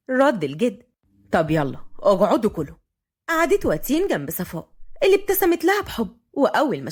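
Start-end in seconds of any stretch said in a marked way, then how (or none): 1.3-2.8 lowered voice of a family elder; grandfather. A narrator's reading. Grandfather to the latecomers, low questioning.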